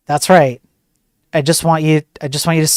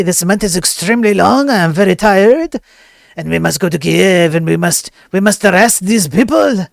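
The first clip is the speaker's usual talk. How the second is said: put-on comic French accent, lightly raised pitch; mock French accent. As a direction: play a pompous French cop. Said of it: "Sacre bleu".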